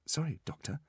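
small, high apology; meek assistant apologising to the boss; contrite. A narrator's reading. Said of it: "said the assistant contritely".

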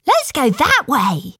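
put-on bright-eyed child taking the lead; decisive child. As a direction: pipe up eagerly with directions. Child answers her father's question.

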